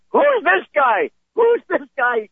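put-on high, insistent jealous boyfriend; mock indignant demand. Maced boyfriend finding another man with his girlfriend, mock indignant.

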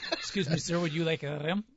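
mock French clerk asking politely, soft; a hint of a hammed-up French hotel clerk; French accent, lightly done. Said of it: "Pink Panther movies".

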